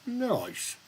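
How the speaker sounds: mock high sing-song "Oh, nice"; quoting her. Streamer imitating how a viewer says nice, high and sing-song. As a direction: lilt it up like the viewer would. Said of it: "With the head tilt and everything".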